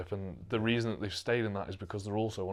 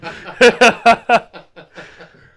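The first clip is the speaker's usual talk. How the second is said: big, high, loud laugh; real loud laughter; loud burst of laughter; 23 dB louder than usual. Real, not acted. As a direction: crack up loudly at your own joke. Laughs after his joking threat.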